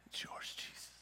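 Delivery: soft whisper of surrender; whispered offering. Acted. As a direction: breathe it out as a gift. Woman offering her savings.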